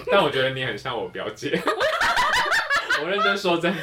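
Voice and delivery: laughing loudly through the words; high burst of laughter mid-sentence. Real, not acted. Laughing while comparing to cousin. (边大笑边说)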